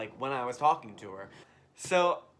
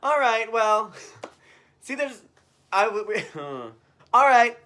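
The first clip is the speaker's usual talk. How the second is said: higher, louder goodbye; loud sign-off; 8 semitones higher, 11 dB louder than usual. Real, not acted. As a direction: wrap up loudly with a laugh. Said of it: "bye, guys".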